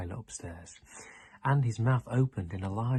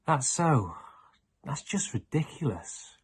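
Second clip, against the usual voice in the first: growled; 5 semitones higher, 5 dB louder than usual. Acted. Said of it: "growled Charlie".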